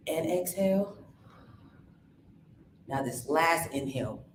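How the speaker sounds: calm breathing coach voice; guided breathing. Acted. Leads a breathing exercise.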